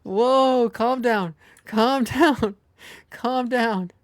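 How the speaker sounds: high, loud urgent calming; soothing a scared dog. Real, not acted. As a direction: hold the animal steady, loudly. Calming the bucking boar.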